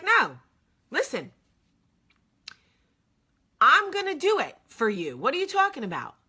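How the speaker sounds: loud, high imitation of a friend overruling her; emphatic insistence. Old friend insists on helping her, imitated loud and high. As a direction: override the objection loudly, imitating him.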